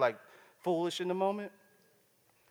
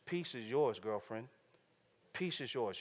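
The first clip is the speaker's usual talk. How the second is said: tender reassurance; 4 semitones lower, 7 dB quieter than usual. Acted. Jesus blessing the woman.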